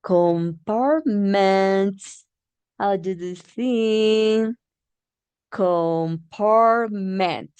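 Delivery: compartment stretched slowly, syllables apart; slow syllable drill. Shown as a demonstration. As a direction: sound compartment out for the class. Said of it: "Our syllable".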